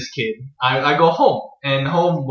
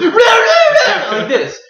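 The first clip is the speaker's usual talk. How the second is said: sudden shrieking pterodactyl cry, much higher and louder; pterodactyl scream; 5 dB louder than usual. Retold or imitated. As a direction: blast a wild pterodactyl screech out of nowhere. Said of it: "at the top of his lungs and flails his arms and goes".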